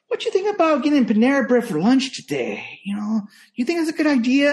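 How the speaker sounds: put-on high kid voice, lilting up into the question; imitating wheedling kid. Kid nudging his babysitter toward Panera, high wheedling imitation. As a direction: mimic a kid floating a hopeful suggestion. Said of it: "that's how he talks".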